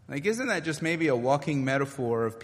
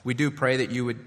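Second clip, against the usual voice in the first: prayer; 5 semitones lower than usual. Acted. Leads closing prayer.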